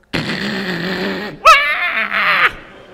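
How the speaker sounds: ratcheting noise into a loud cry; mock baby screech after a grinding crank; imitated crank and wail. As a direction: crank noisily, then wail like a woken baby.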